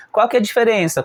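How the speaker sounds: ordinary talk, nothing marked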